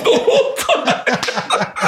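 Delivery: words dissolving into laughter; laughing. Real, not acted. Telling friends about the rooftop party, real laughter. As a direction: crack up while finishing the sentence. Cracking up at own story.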